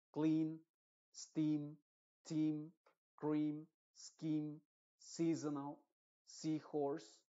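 each word read out clearly with a long ee; careful model pronunciation. Shown as a demonstration. Teacher drilling EA words: held long ee. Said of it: "la vocal tienes que hacerlo larga".

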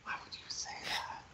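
hushed, whispered disbelief; scandalized whisper. Real, not acted. Friend scolding Hazel for the confession, appalled whisper.

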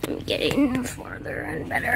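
soft unvoiced whisper. Real, not acted. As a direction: hush it as you crawl in. Crawling into the fort.

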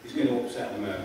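low, soft and understated; low-key, almost to oneself; quiet understatement. Real, not acted. First, hushed take of a repeated line.

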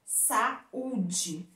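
SAÚDE with SA held apart from Ú-DE; split at the hiatus. Shown as a demonstration. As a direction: break Saúde between the A and the Ú. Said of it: "o U está na segunda sílaba".

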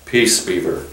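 understated lion's growl; low growl. A narrator's reading. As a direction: growl it low, as a restraining command. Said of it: "with a very low growl".

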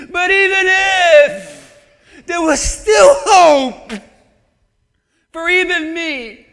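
loud, wailing lament; bitter Naomi. Retold or imitated. Preacher acting Naomi complaining to her daughters, loud and wailing. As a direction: cry out like a bitter widow blaming God.

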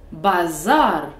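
"bazar" said baZAR, loud on the end; stress on last syllable. Shown as a demonstration. Teacher showing stress on a real word, "bazar" stressed at the end. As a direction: hit the ZAR of "bazar". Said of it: "voici comment ça sonne avec de vrais mots".

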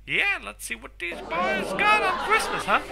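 high, put-on grating voice; squeaky teasing voice; annoying voice. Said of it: "sorry for my annoying voice".

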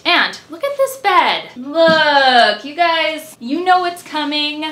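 high, eager reveal; bright teasing build-up; excited. Real, not acted. Showing off bed to viewers.

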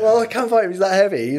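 can't keep a straight face while saying it; laughing through it. Real, not acted. Retelling a mismatched fight offer.

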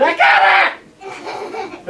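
very high, slow, loud cry; triumphant shout. Retold or imitated. Sister running home with the frog, triumphant shout. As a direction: yell in victory. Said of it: "She said".

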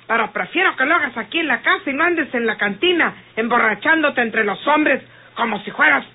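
ordinary talk, nothing marked

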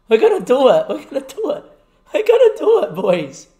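high, loud cries of disbelief and joy; elated shouting. Real, not acted. Team about to win the final.

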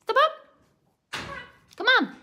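very high pet-talk voice; coaxing a pet. Real, not acted. Owner coaxes bird to step up, high-pitched pet talk. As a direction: coax the bird with high little commands.

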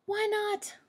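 put-on child rabbit questioning mother; squeaky child protest. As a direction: protest like a little bunny.

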